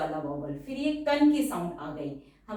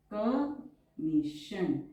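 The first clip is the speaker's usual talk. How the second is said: each syllable drawn out very slowly; slow dictation; much slower than usual. Shown as a demonstration. Shorthand teacher dictating a word to students, drawn out very slowly. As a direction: dictate it so students can write along.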